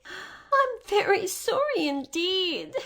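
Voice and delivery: put-on frightened girl. A narrator's reading. Frightened Dorothy apologizes.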